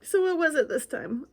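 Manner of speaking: ordinary delivery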